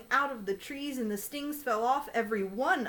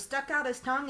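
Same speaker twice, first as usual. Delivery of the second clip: ordinary delivery, nothing marked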